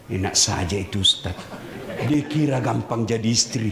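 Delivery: grumbling wife. Retold or imitated. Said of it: "pasti dia bilang".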